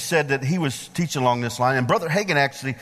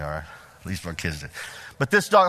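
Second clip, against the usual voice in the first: normal talking voice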